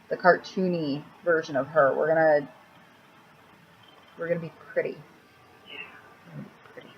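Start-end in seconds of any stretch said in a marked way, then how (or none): 6.3-6.9 soft, breathy whispered repeat; whispered aside. Real, not acted. Repeating to herself under her breath, whispered.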